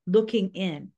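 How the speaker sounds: ordinary delivery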